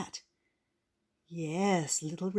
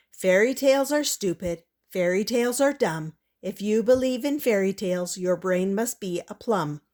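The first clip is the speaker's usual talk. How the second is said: louder, sing-song scornful rhyme; mocking poem; 5 dB louder than usual. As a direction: recite it defiantly. Prudence recites scornful poem.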